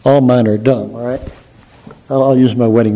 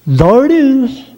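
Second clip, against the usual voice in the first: dopey suitor; 10 semitones higher, slower than usual. Acted. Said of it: "And he says".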